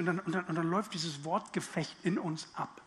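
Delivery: ordinary talk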